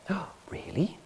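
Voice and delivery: breathless imitated whisper of wonder; mock whispered amazement. Visitor hearing the medal explained, whispered mock awe. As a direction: gasp it out as if astonished.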